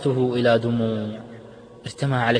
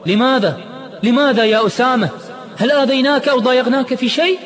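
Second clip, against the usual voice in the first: frantic yell, much higher and louder; desperate scream; 13 semitones higher, 7 dB louder than usual. Acted. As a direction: cry out without thinking. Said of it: "صرخ عماد دون وعي".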